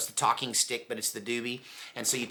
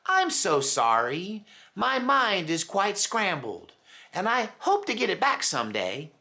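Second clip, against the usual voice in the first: sweet apology; 8 semitones higher than usual. Retold or imitated. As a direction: gently apologize as a confused old woman. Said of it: "apologetically and so sweetly look you in the eye and say".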